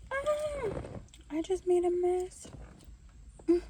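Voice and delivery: high startled oops. Real, not acted. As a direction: caught off guard by a spill. Spills food mid-sentence.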